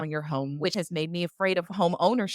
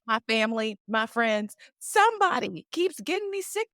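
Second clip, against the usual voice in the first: high-pitched, rattled-off list of suspects; venting in repeated high bursts, building to an outburst; fed-up rant that peaks high on the last line; exasperated rant; 4 semitones higher than usual. Real, not acted. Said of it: "to just hear you rant".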